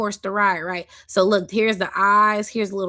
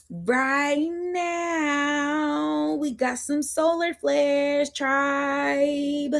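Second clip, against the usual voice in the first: excited; 6 semitones higher, much slower than usual. Real, not acted. Excited at solar flare.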